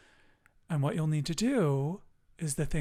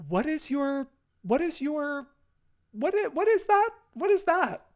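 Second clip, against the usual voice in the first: mock puzzled; 10 semitones higher, 3 dB louder than usual.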